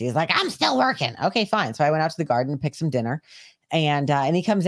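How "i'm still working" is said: high, irritated retort; mock a pissy partner snapping back. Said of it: "he was all pissy".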